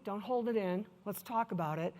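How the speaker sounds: soft, soothing encouragement; gentle coaxing. Retold or imitated. Manager to a crying employee, gently encouraging. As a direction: softly draw them out, no pressure.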